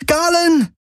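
very high, sharp warning cry of his name; sudden scream. Acted. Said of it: "schrie Kirai plötzlich".

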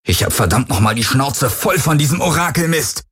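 fed up, snapping at the voice in his head; understated furious shout; angry yell. A narrator's reading. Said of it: "brüllte er die Helix an".

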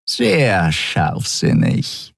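very slow, drawn-out purr; silky villainess stretching each word. A narrator's reading. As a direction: purr it mockingly close to the ear. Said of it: "schnurrte Shiar dicht neben seinem Ohr".

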